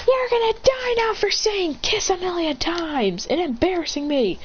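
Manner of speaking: high-voiced furious girl; angry girl. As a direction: threaten him in a girl's high voice. Naruko's furious final strike.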